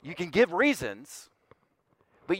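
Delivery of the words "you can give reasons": ordinary talk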